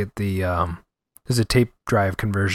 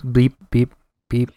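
censor beep; 3 dB louder than usual. Shown as a demonstration. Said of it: "beep that".

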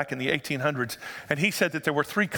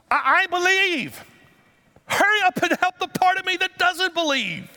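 desperate scream; 12 semitones higher, 5 dB louder than usual. Retold or imitated. Preacher acting the father screaming at Jesus, desperate. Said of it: "he literally in Greek screams this".

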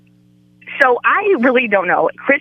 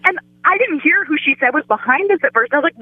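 usual speaking voice

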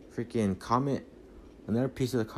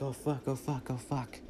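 higher, hushed mock panic; panicked swearing kept under the breath; muted panic; 4 semitones higher, 6 dB quieter than usual. Driver racing toward the runway, muted panic. Retold panic as truck races the plane.

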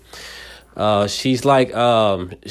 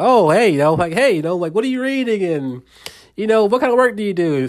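bubbly and much higher, eager questions; 10 semitones higher than usual. Retold or imitated. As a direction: chirp it out like an overeager fan. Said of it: "like, bubbly".

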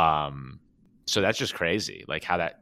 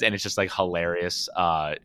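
ordinary talk

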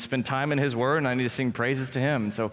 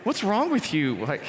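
daughter embarrassed; 7 semitones higher than usual. Retold or imitated. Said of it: "She's like".

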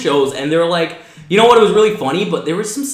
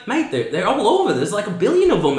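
higher-pitched imitation Kiwi accent; rough New Zealand accent, pitched up; 5 semitones higher than usual. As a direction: explain it as an incredulous Kiwi cousin. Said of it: "Your Kiwi accent is almost there".

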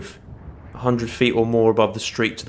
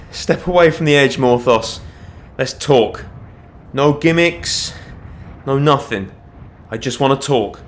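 loud, raised negotiating voice; urgent appeal; 4 semitones higher, 6 dB louder than usual. A narrator's reading. Watchman talking a fugitive back from the edge, urgent appeal. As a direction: call across to them, urging them to step back.

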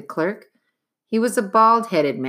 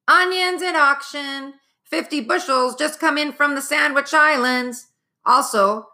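auctioneer calling out; 9 semitones higher than usual. Acted. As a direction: bellow the wares to the street. Street auctioneers crying their wares.

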